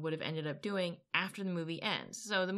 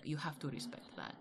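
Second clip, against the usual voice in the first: low, soft hint of a Russian accent; a lightly done Russian accent, murmured; mild Russian accent; 3 semitones lower, 9 dB quieter than usual. Old man approving her vodka, in a light Russian accent. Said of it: "he had this heavy Russian accent".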